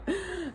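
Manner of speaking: short high laugh; rueful laugh. Real, not acted. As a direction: laugh at yourself. Laughs at own tears.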